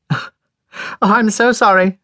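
high and loud, laughing through the words; cried back laughing. A narrator's reading. Lilia calling from the train, laughing. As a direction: call back while laughing. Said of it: "she cried back".

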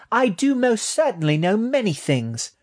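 very high, indignant rat voice; high-pitched small creature insisting; retorting baby rat. A narrator's reading. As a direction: retort as an affronted little rat. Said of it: "retorted Eve".

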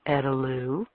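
a low, calm soothing voice; softly calm her down. A narrator's reading. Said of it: "kept his voice low and calm".

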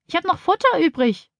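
rufen. A narrator's reading. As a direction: call out in a high child's voice. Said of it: "ruft Tina". (hohes, kindliches Rufen)

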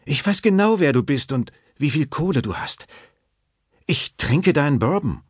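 hohe Säuselstimme der Frau; säusle selbstbewusst in hoher Stimmlage; säuselnd. A narrator's reading. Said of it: "säuselte sie". (kokett gesäuselt, deutlich höher)

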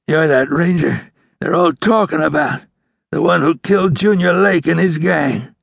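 high, strained wounded outlaw voice; dying outlaw. A narrator's reading. Wounded outlaw recognizing the famous ranger, strained. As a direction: talk through the pain.

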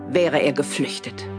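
normal talking voice

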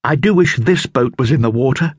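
Dick. A narrator's reading. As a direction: read it as young Dick. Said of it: "said Dick".

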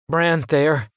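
put-on small kid answering shyly; young boy. A narrator's reading. Boy tells his rescuer his name, lightly voiced.

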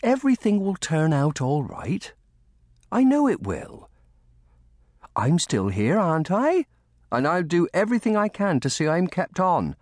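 high-pitched, caring servant voice; reassuring nursemaid. A narrator's reading. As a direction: comfort a worried child. Lucy comforts the orphan boy.

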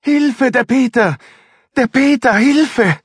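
high, loud screams for help; a panicked woman screaming from the cellar. A narrator's reading. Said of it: "laut schreiend".

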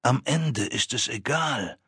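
viel leiser, langsam, fast verlöschend; gesenkte Stimme. Acted. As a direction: senk die Stimme bedrohlich. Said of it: "Er senkte die Stimme". (leise, langsame, bedrohliche Stimme)

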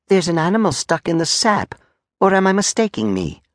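high, inquisitive soldier; soldier Clot. Acted. Soldier spotting an animal in the sap, high and inquisitive. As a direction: point it out in a high, polite voice.

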